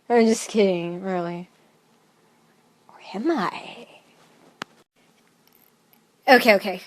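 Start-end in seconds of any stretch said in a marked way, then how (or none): 2.9-3.9 hushed, playful suspense; teasing whisper. Pretend. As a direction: whisper it like a secret twist. Teasing doubt after just kidding.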